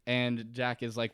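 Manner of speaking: ordinary talk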